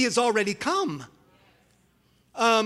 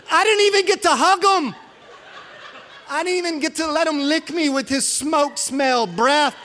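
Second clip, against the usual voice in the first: laughing mock lament; 7 semitones higher, 4 dB louder than usual.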